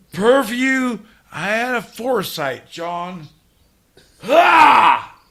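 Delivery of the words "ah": loud high angry cry; frustrated yell. Real, not acted. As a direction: let out a howl of frustration.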